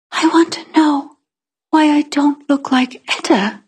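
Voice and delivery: tiny, high half-whisper; timid child's whisper, pitched high; smallest voice. A narrator's reading. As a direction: say it small and breathy. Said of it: "I said in my smallest voice".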